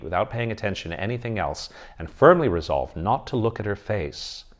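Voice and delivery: plain reading voice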